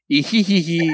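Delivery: high cartoon villain giggle; hammed-up evil laugh; villain cackle. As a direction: cackle like a Saturday morning cartoon baddie. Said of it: "read Skeletor or Rapscullion's entire speech".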